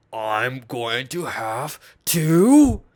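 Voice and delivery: very slow, drawn-out mock threat; Anthony Fantano. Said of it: "He said".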